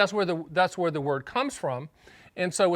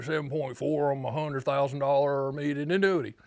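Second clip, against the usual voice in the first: put-on North Carolina accent; 3 semitones lower than usual. Stan quoting the caller's annuity rate, in a light North Carolina accent. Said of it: "so I can do that accent very well".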